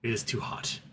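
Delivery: lightly voiced resentful dog; grumpy dog. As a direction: mutter it like a miserable overheated pet. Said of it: "She'd just look at me like".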